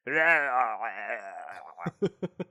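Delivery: loud wordless mock growling; imitated villain noise. Correcting the co-host's translation, wordless villain imitation. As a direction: garble it into monster-like gibberish. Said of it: "He doesn't say, "Give me the stuff." He says".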